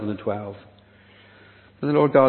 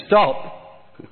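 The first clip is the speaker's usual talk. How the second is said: higher and louder, a firm halt; urgent command; 5 semitones higher, 4 dB louder, much slower than usual. Retold or imitated. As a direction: cut them off with a commanding Stop. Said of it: "God is saying".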